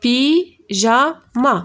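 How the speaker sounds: "Pyjama" drawn out slowly; slow syllables. Shown as a demonstration. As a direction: stretch "Pyjama" for the class. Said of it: "Trois syllabes".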